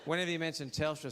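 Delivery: normal talking voice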